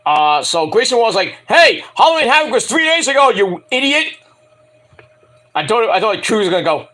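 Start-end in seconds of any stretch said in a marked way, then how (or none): 1.5-4.1 high-pitched mock outraged yell; mock scolding. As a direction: imitate a wrestler telling off an idiot. Mimics Grayson scolding rival.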